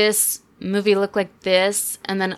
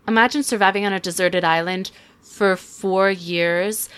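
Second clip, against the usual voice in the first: normal talking voice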